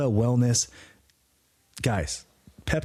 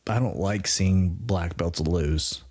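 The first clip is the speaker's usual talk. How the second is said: normal talking voice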